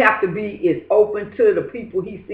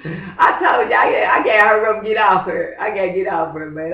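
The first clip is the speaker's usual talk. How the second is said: exasperated outburst. Real, not acted. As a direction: blurt it out, done with her. Frustrated outburst about the woman.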